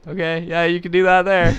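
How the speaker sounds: high, loud nervous agreement. Retold or imitated. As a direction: humor the stranger nervously. Nervously humoring the stranger.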